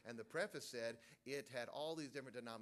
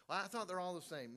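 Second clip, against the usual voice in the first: higher, louder mimicked objector; mock objection; 6 semitones higher, 6 dB louder than usual. Preacher imitating someone who thought all Bibles matched, mock protest. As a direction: parrot the naive protest.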